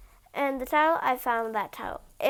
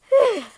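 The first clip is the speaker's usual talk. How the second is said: high loud whew; relieved whew; 10 semitones higher, 9 dB louder than usual. Acted. The pea, freed from the mattresses, letting out a high loud whew. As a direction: let out a big relieved whew. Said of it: "the pea's like".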